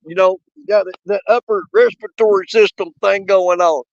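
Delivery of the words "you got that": ordinary talk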